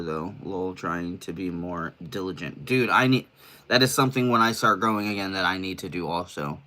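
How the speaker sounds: normal talking voice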